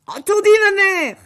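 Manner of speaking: high, loud, shrill reply; eager ogress daughter. Acted. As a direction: answer mother sharply. La fille obéit à sa mère. (la fille répond à l'ogresse, aiguë et forte)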